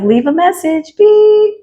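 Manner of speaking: high, sing-song answering-machine voice; voicemail greeting. Retold or imitated. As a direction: imitate a voicemail, with a high "beep". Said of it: "It's like".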